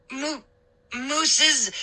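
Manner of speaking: choked breathless gasp; wheezing. A narrator's reading. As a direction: squeeze words out under weight. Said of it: "I wheezed".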